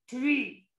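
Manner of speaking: plain reading voice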